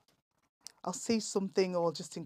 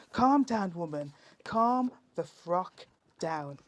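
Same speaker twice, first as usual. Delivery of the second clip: rhythmic, punchy performance-poetry delivery; comic poet performing verse for the listeners; poem recital; 3 semitones higher, 4 dB louder than usual. Acted. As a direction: lean into the rhymes and land each punchline.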